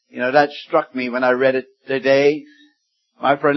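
usual speaking voice

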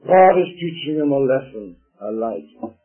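normal talking voice

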